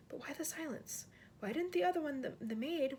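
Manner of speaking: ordinary delivery, nothing marked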